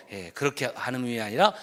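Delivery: normal talking voice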